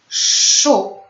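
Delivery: "szu" with a long hushing sz before the vowel; drawn-out SZ. Shown as a demonstration. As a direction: model the syllable "szu", sz held long. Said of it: "Pamiętamy język wysoko".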